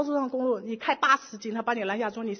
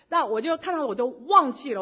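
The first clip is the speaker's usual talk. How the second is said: ordinary delivery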